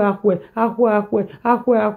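ordinary delivery

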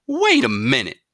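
high, loud mother's shout; commanding shout. A narrator's reading. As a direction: stop him in his tracks. Mother stops son.

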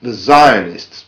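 slow, weighty "the Zionists", each word pressed down; stress on the Zionists. Real, not acted. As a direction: lean on "the Zionists" to set them apart. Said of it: "and I stress".

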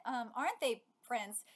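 ordinary talk, nothing marked

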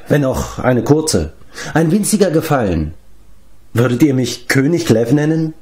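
high-pitched, coaxing, coy request; wheedling giant Glev. Acted. (schmeichelnd bittender Riese)

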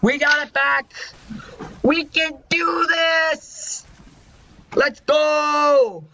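high, hopeful rally cry; rally the team, high-pitched; excited. Real, not acted. Team regains the point.

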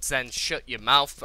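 loud, slowed reprimand; self-scolding. Real, not acted. Gamer silencing himself, loud scolding. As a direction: tell yourself off loudly.

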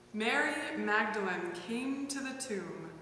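ordinary talk

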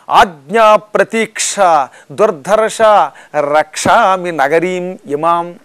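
slow, loud chanted Sanskrit; loud slow proud chant; verse recitation. Acted. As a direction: intone the verse with force.

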